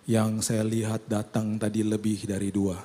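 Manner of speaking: slow, low, lightly voiced dying uncle; weak dying man. Dying uncle telling the pastor what he sees, slow and weak. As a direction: let the words drag out like a frail old man.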